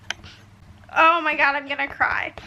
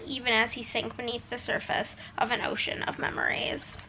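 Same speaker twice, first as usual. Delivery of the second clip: softer, unvoiced whisper; hushed whisper; 2 semitones lower, 9 dB quieter than usual. Real, not acted. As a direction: drop into a breathless whisper. Reading gripping passage hushed.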